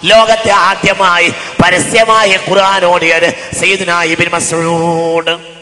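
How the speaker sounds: loud intoned proclamation, long final name; sing-song preaching. Shown as a demonstration. Preacher proclaiming the first public reciter, loud chanted preaching. Chanted statement, then spoken again.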